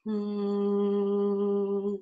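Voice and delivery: buzzing hum at the lips; forward hum. Shown as a demonstration. Coach demonstrating a forward-focused resonant hum. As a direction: hum with vibration in the front. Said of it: "a hum where you feel more forward focus".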